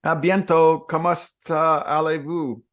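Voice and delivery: put-on playful schoolbook French accent; mock French.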